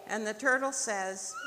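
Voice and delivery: ordinary delivery, nothing marked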